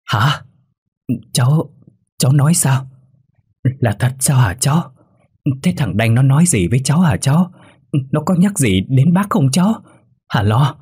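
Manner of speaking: agitated, high-pitched astonishment; stammering shock. A narrator's reading. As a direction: stammer in disbelief, then press anxiously for answers. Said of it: "Bà Liễu ú ớ".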